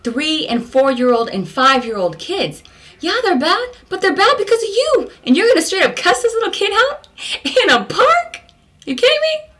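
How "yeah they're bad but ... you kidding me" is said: indignant rant. Real, not acted. Berating the father who cusses his kids, high and outraged. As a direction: scold him in outraged disbelief.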